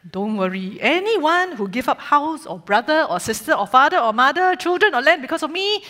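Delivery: put-on light Singlish storytelling accent. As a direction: tell it in Singlish, Singapore style. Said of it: "this story is going to be in Singlish".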